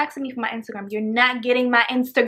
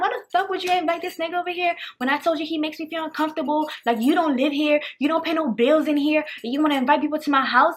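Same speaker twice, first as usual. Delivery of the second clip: higher-pitched telling-off, lightly heated; mild mock outrage, pitched up; retold indignant scolding; 4 semitones higher than usual. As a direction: scold him as if replaying the argument. Retold argument with her boyfriend.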